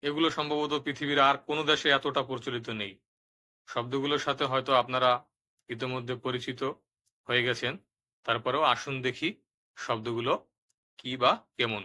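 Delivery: ordinary delivery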